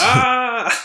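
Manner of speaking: sudden high yelp of alarm; loud, high startled ah; startled cry. Retold or imitated. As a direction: jump and cry out. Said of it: "y es en plan".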